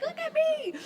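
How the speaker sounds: put-on very high, thrilled toddler squeal; tiny proud baby voice; excited baby voice. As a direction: squeak it out like a beaming baby at his walker. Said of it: "He's like".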